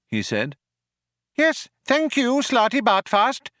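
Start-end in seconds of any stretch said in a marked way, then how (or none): 1.3-3.5 very high, sharp mouse voice. A narrator's reading. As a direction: dismiss him sharply in a squeaky mouse voice. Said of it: "said Benji Mouse sharply".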